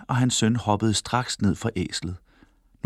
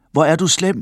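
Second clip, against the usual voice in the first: higher, louder scolding call; 7 semitones higher, 5 dB louder than usual. A narrator's reading. Man by the road scolds the riding father, calling out. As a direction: call out a high-pitched reproach. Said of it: "råbte manden til Joha".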